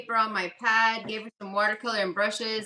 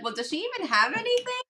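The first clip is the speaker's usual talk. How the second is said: skeptical mom; 10 semitones higher, faster than usual. Retold or imitated. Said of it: "and the mom was like".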